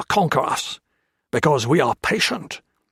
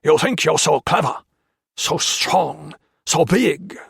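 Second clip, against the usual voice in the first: raised, loud snarling voice; snarled threat; 5 semitones higher, 4 dB louder than usual. A narrator's reading. Japanese officer snarls threats at the prisoners, loud and hateful. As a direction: snarl it out with contempt. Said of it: "he snarled".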